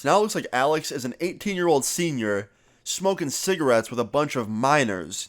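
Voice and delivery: ordinary delivery, nothing marked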